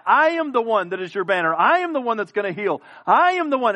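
loud, high proclamation; preaching at full force. Acted. Preacher voicing God's titles, at full force. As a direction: proclaim each title as God.

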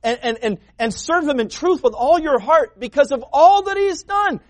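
fervent pulpit delivery; preaching. Acted. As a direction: preach it out to the congregation. Preaching at full force.

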